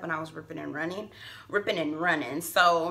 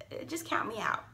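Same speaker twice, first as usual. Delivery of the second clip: whispered aside; 6 semitones higher, 8 dB quieter than usual. Real, not acted. Dismissing a backup-plan man.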